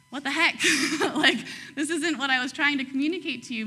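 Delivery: mock frustrated God, laughing through it; hammed-up what the heck with a laugh; playful laughing exasperation. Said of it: "was like".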